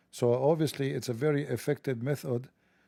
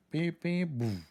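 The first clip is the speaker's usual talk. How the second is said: imitated bomb beeps; 4 semitones higher, slower than usual. Comedian acting out a booby trap, beeps and boom. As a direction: mimic a device beeping and exploding.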